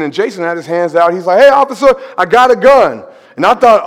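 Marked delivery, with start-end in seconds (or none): 1.4-3.0 high, loud announcing a gun to the cop; loud blurt. Retold or imitated. Friend loudly tells the officer he has a gun. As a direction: blurt it out like a warning.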